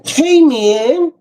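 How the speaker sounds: high, bright mock-polite hey man; polite greeting. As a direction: put on a sweet fake greeting. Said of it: "I don't talk like that".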